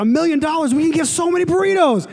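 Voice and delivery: high, eager burrito hype; giddy mock excitement over free burritos; excited hype. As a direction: sell it like free food just dropped.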